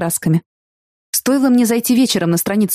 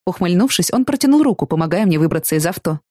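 usual speaking voice

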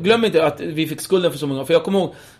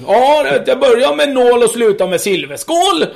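higher, louder cop lecturing; 7 semitones higher, 6 dB louder than usual. Retold or imitated. Cop to dad, higher and louder. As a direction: preach the proverb at the dad. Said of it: "cop proverb".

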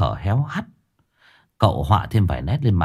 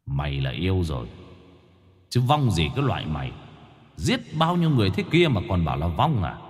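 gritted voice-wave; 2 semitones lower, 7 dB quieter than usual. Acted. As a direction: speak with an otherworldly voice, jaw still. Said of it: "gằn giọng, nói bằng sóng âm".

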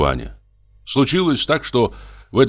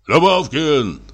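loud call; 8 semitones higher, slower than usual. A narrator's reading. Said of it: "вдруг крикнул он так громко".

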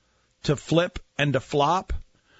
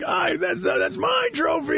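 high, shouted mock Lithuanian accent. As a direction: ham up a Lithuanian accent for a giant robbed of his trophy. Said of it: "It's a terrible Lithuanian".

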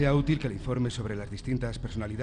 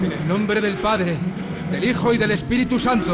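shouted prayer; 9 semitones higher, 9 dB louder than usual. Acted. Young priest shouting responses in the exorcism. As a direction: shout the prayer over the demon.

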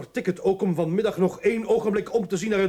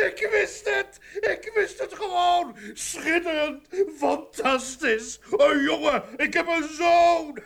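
laughing, much higher, excited cries; overjoyed; 9 semitones higher, 4 dB louder, slower than usual. Acted. Father hearing of his son's birth, laughing and overjoyed. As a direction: burst with a new father's joy.

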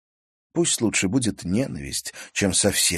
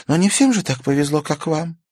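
put-on higher young girl's voice, rueful; girl Karina voiced; 9 semitones higher, 6 dB louder than usual. A narrator's reading. Karina admits her foolishness to Stas, higher girl's voice. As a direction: play Karina, higher and lighter, sheepish.

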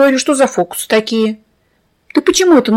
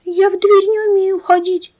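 put-on high, thin little girl's voice; tiny thin voice; 9 semitones higher than usual. A narrator's reading. The girl Fenka answering the narrator, tiny thin voice. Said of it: "тоненьким-тоненьким голоском отвечает".

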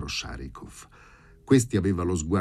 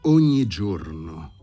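loud, slow, emphatic opening; heavy, deliberate menace; menacing threat; 2 semitones higher, 7 dB louder, much slower than usual. A narrator's reading. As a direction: press each word as a warning. Furious threat, gripping his lapels.

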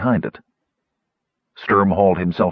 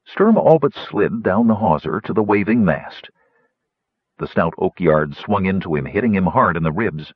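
ordinary delivery

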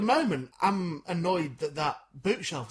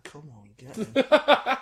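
mock bright invite; 6 semitones higher, 6 dB louder, much slower than usual. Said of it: "it's like".

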